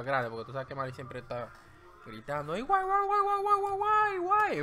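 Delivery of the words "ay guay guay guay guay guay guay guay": wailing siren, much higher and louder; siren imitation. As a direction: whoop it out like a passing siren. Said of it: "Una sirena".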